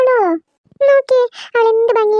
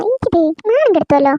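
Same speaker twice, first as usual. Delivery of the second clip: ordinary delivery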